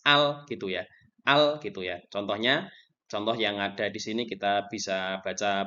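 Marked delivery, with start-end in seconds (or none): 0.1-0.5 "Al" said with the l fully and distinctly sounded; clear al. Shown as a demonstration. Teacher showing students how to sound al clearly, crisp l in "Al". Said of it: "maka alif lamnya itu harus dibaca jelas". 1.3-1.6 "Al" said with the l fully and distinctly sounded; clear al. Shown as a demonstration. Teacher showing students how to sound al clearly, crisp l in "Al". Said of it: "maka alif lamnya itu harus dibaca jelas".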